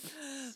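high, sharp intake of breath; mock-shocked gasp. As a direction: gasp as if scandalized. Mock shock before spoiler.

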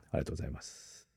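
breathy whispered thank-you, barely audible. Real, not acted. Co-host whispers ありがとうございます while finishing a purchase. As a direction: say ありがとうございます under your breath.

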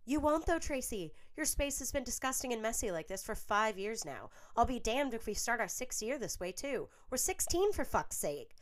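high, loud, fed-up scolding; heated retort. A narrator's reading. Millicent yells at Tracy over her mess, loud and shrill. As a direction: tear into her messy roommate. Roommates screaming at each other.

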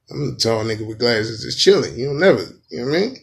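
usual speaking voice

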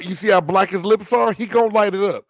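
loud, high, teasing put-down; mocking excitement. Real, not acted. Cohost roasting a fan's running back, loud and mocking. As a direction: hype it up to roast him. Teasing a fan about Zeke.

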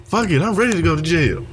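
high, fast mocking bravado. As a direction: toss it off like a fake tough guy. Mocking the bagel guy's bravado.

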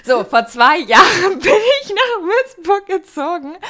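holding back laughter; stifled giggles. Real, not acted. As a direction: barely keep the giggles in. Beginnt neu, noch kichernd. (unterdrücktes Kichern)

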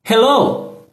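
lifted, bright model hello; high cheerful hello. Shown as a demonstration. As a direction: sing out the hello for learners. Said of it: "I speak and you repeat". (olá alegre e agudo)

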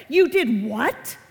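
loud, drawn-out disbelief rising at the end; shocked parent reaction. Acted. Parent reacting to a child's confession, loud disbelief. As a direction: blurt it out, stretched and incredulous. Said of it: "you're gonna go like this".

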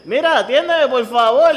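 high, loud, laughing plea; mock the old folks pleading through the door, laughing; begging old customer. Mocking old shoppers who poke in at the butcher, high laughing plea.

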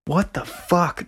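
high, slowed what the fuck; high astonished drawl; stunned disbelief. Real, not acted. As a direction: let it fall in disbelief. Repeats shock at age.

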